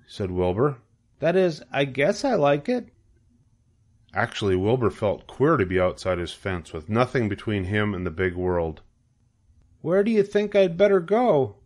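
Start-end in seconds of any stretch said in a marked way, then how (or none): 1.2-2.9 high, hesitant piglet voice; unsure young pig. A narrator's reading. Pig unsure he likes being free, high and hesitant. 9.8-11.6 high-pitched piglet question; questioning young pig. A narrator's reading. Newly free pig asking goose the way, high and questioning.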